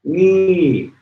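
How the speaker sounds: "me" said high then falling sharply. Shown as a demonstration. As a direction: drop the pitch on "me" from high. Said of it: "that would be a falling tone".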